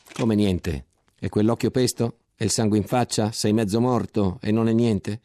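più forte, domande incalzanti; incredulo e indignato. Acted. Tenente incredulo incalza il ferito. (il tenente incalza il soldato ferito, più forte e indignato)

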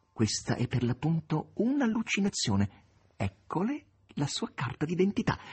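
high-pitched exclamation; exclaiming. A narrator's reading. Korofiev hands the maestro his papers, exclaiming high. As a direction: exclaim it high, as if correcting a mistake. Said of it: "esclamò Korofiev".

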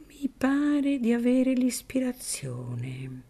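slow, hushed prophetic whisper; mysterious whisper. Acted. Don Cirillo feigns inspiration to Filippino in a slow whisper. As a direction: whisper it like a divine revelation.

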